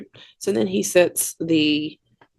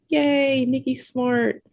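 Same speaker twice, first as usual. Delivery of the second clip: high-pitched little cheer; lightly cheer, pitched up, as a retold thought; mock cheer; 8 semitones higher, slower than usual. Voicing own relieved cheer.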